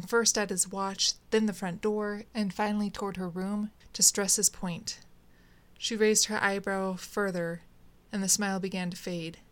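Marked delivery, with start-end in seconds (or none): none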